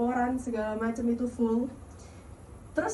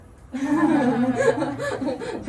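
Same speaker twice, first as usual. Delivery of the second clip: bright embarrassed burst of laughter, higher and louder; nervous laugh; 4 semitones higher, 6 dB louder than usual. Real, not acted. Speaker to audience after asking if they understood, nervous laughing. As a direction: laugh off the nerves.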